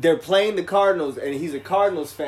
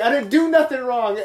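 louder, higher plea of innocence; raised, higher voice defending yourself; protesting innocence; 8 semitones higher, 6 dB louder than usual. Retold or imitated. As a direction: insist you did nothing to the bartender. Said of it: "I was like".

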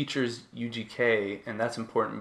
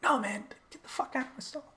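mock exasperated refusal. Stall occupant refusing the man's request, high mock exasperation. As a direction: squeeze the protest up into a high voice.

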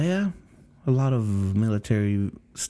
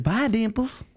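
high mock cashier; 12 semitones higher than usual. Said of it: "she's like".

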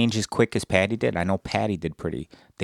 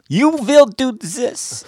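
slow high robotic German voice; German accent; 8 dB louder, much slower than usual. Retold or imitated. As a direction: stiff German computer voice. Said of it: "if you got the German computer".

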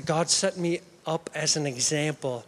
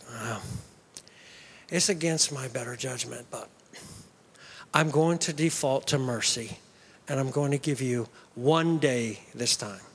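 low reluctant professor; 7 semitones lower than usual. Retold or imitated. Professor grants one day.

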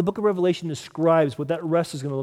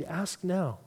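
soft invitation; 9 dB quieter than usual. Acted.

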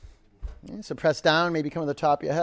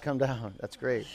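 ordinary talk, nothing marked

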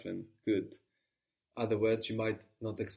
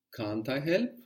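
normal talking voice

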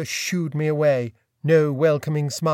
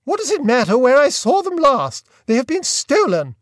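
high, louder exasperated retort; irritated aunt; 8 semitones higher, 5 dB louder than usual. A narrator's reading. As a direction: scold him for a silly question. Aunt voiced in story.

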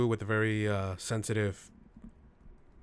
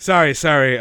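loud; 4 semitones higher, 12 dB louder than usual. Real, not acted. Apologizing to Marvel loudly.

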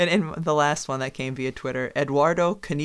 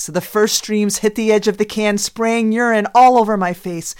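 louder and higher on the climax; dramatic loud build; 6 semitones higher, 8 dB louder than usual. Pretend. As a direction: punch the gross climax loudly. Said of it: "It would be something like this".

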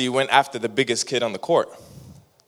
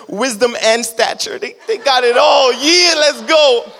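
put-on high, loud, bragging hype about the kids; proud hype cheer; 8 semitones higher, 4 dB louder than usual. Proud parent hyping his kids on the court, loud and high. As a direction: hype up your kids from the sideline. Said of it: "I was like".

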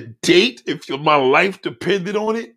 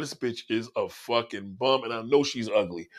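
ordinary talk

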